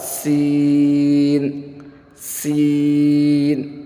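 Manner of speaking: سین with a simple "s" and long "ee"; slow model recitation of سین; plain hissed seen. Shown as a demonstration. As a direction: hold the vowel of سین on a level note. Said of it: "پڑھیں میرے ساتھ".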